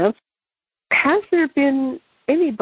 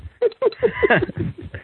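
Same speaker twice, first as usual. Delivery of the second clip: high giggle. Real, not acted. Laughs at own song joke.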